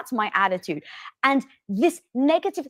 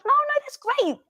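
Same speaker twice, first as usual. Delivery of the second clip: much higher brushing it off; dismissive. Retold or imitated. Wife brushing off husband's news, high. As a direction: wave it off lightly. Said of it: "I was like".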